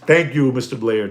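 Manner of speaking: ordinary delivery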